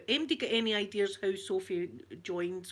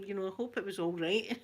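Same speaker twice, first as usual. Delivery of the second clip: usual speaking voice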